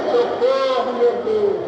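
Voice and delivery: a half-acted shout to God for rescue; retold call for help. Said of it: "continuei a gritar e falei".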